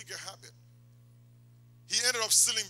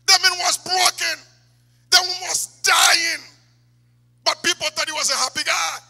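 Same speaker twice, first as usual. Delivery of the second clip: loud, high, stretched-out sermon cry; preaching at full force; 11 semitones higher, 14 dB louder than usual. Real, not acted. Preacher on the comedian's hidden unhappiness, loud and impassioned. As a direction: drive each sentence home at a shout.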